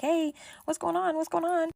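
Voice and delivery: high-pitched, asking what's going on; high questioning friend. Retold or imitated. Friend tapping Mark, asking.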